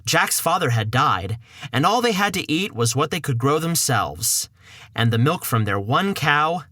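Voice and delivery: ordinary delivery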